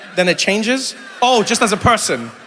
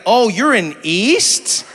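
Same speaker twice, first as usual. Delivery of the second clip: slow drawn-out question; 4 semitones higher, 4 dB louder, much slower than usual. Retold or imitated.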